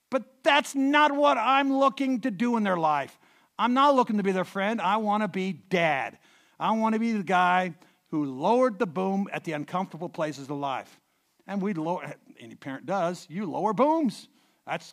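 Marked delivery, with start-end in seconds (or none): 0.1-11.0 full-force sermon exhortation; preaching exhortation. Real, not acted. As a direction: drive it home to the congregation. Preacher insisting on being Dad.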